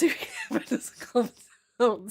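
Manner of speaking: real laugh bubbling through the sentence; laughing while talking. Real, not acted. Streamer to viewers about motion sickness, laughing.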